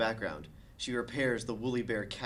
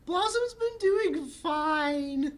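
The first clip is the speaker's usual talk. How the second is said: put-on very high, bright girly voice; squeaky cheerful sister; Bubbles voice; 5 dB louder, much slower than usual.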